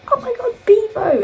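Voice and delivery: very high, loud squeal; excited exclamation. Retold or imitated. People at the bar turn round and spot Bevo, excited exclamation.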